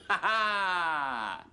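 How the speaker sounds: long, drawn-out "ha ha" laugh; mocking laugh. Quizmaster after springing guilty verdict, mocking laugh. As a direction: laugh at catching them out.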